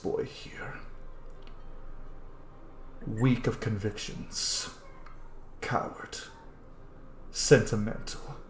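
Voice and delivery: whispered threat; menacing general. Acted. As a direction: hiss the insults softly, almost in his ear.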